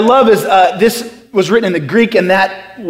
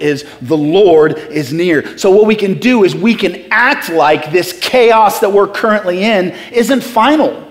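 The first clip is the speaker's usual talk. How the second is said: ordinary delivery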